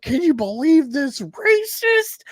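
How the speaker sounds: high, slow offended squeal; mock outraged viewer. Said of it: "they were triggered by it".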